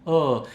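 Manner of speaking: hollow, yawning "oh"; little inner yawn. Shown as a demonstration. Teacher showing a back-of-throat sound, yawny "oh". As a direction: make an "oh" as if stifling a yawn. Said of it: "como si fuera un bostecito".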